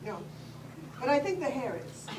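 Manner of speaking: ordinary talk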